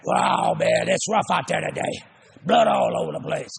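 lightly voiced pit trader; a hint of a gruff broker griping; mock trader's brag; trader talk. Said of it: "they all talk like this".

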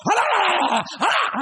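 high, loud mock hollers; a hammed-up pit trader's yell; imitating traders hollering. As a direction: holler like the trading floor. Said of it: "They screaming and hollering".

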